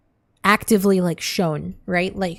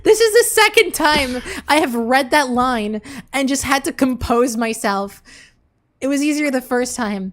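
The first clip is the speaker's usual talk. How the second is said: high, loud, fighting back laughter; stifled giggles; 5 semitones higher, 5 dB louder than usual. Real, not acted. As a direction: hold in the giggles while reading. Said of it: "I'm trying not to laugh".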